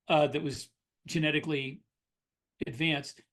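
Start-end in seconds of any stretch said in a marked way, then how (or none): none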